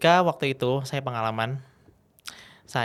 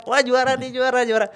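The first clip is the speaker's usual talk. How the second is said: high, loud mock cheer; acted winner's elation; 12 semitones higher, 7 dB louder than usual. Retelling a contestant's joy at winning, high excited cheer. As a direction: crow over a win, imitating the champion.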